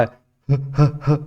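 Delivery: put-on short isolated "ہ، ہ، ہ", like a lisping child; lisping baby-talk sound. Shown as a demonstration. Teacher showing parents the letter's sound, lisped "ہ، ہ، ہ". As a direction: say "ہ" three times in separate little puffs, childlike. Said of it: "آپ توتلی زبان کا سہارا لے سکتے ہیں".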